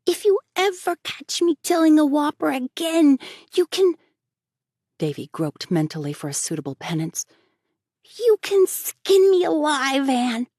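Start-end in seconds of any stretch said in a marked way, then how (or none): 0.0-4.0 put-on high, loud little boy, pleased with himself; a cheeky boy grinning at his prank; boy's voice. Davy's voice. 8.2-10.5 put-on high, loud little boy, pleased with himself; a cheeky boy grinning at his prank; boy's voice. Davy's voice.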